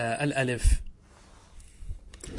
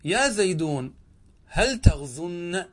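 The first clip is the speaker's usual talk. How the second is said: drawn out, stress on هل; teacher spelling out يا زيدون هل تغزن slowly; slow model sentence; 3 semitones higher, 3 dB louder, much slower than usual. Shown as a demonstration. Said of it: "فتقول هكذا".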